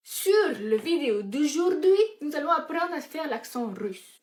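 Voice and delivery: slow, loud mock Russian accent. Presenter announcing an accent lesson, hammed-up Russian accent. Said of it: "Je sais pas faire l'accent russe".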